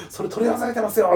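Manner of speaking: high-pitched mock telling-off; retold scolding yell. Campsite staff scolding a guest late at night, retold high yell. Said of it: "すごい、キャンプ場の人にこんなに夜中に".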